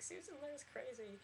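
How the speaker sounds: high, drawn-out tearful whine; slow, high-pitched pretend weepy voice; mock crying. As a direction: imitate yourself whimpering a complaint. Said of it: "walking down the hallway crying".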